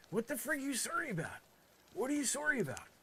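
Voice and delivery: high-pitched, exasperated questioning; mock exasperation. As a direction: demand it in a high, hammed-up fluster. Mock outrage at apologising.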